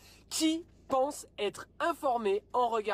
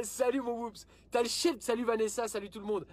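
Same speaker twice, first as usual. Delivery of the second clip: usual speaking voice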